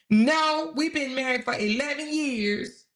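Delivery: high voice, drawing out the retold answer; mock emphatic correction. Wife corrects host on how long they've been married, mock emphatic.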